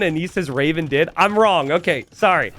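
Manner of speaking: ordinary delivery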